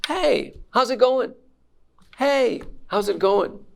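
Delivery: bright, casual "Hey, how's it going?" said high and lively; casual greeting. Shown as a demonstration. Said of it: "we hear".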